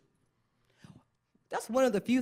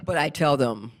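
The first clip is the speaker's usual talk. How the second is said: ordinary talk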